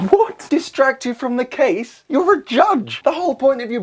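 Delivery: high indignant exclamation; mock-outraged; outraged disbelief. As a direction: scold the judge in disbelief. Outraged at judge's remark.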